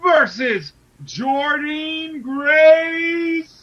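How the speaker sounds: high, loud, drawn-out ring announcer call; fighting game announcer. Pretend. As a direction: stretch each name like a fight intro. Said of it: "I got my announcer voice ready".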